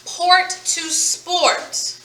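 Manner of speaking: "Port to sport" slow and drawn out; slow demo read. Shown as a demonstration. Teacher repeating port to sport slowly for class. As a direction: draw out the s in "sport". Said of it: "adding another sound at the beginning".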